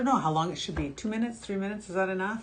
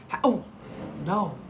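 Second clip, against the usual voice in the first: normal talking voice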